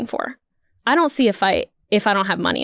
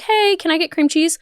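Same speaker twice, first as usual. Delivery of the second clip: high, dithering, sugary customer-service voice; LA bagel clerk stalling over the cream cheese, pitched up; overly apologetic LA server; 5 semitones higher than usual. Retold or imitated. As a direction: drag it out, sorry about everything. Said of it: "this is how that scenario would go".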